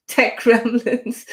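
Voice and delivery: real laugh through the words; laughing at tech trouble. Real, not acted. Host laughing off streaming glitches, amused.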